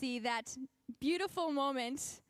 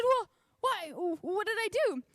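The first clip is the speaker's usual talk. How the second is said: surprised; 8 semitones higher, 4 dB louder than usual. Retold or imitated. Surprised reply to the friend.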